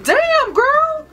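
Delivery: high-pitched drawn-out exclamation of approval; sassy amazement. Retold or imitated. Vlogger hyping her own reflection in the locker room, sassy amazement. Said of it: "and I'm like".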